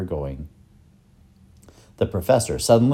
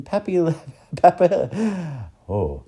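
stumbling laugh; 8 semitones higher, slower than usual. Acted. Stumbling over a long word.

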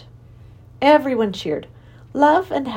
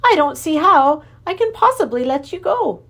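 worried king; 8 semitones higher, 5 dB louder than usual. Acted.

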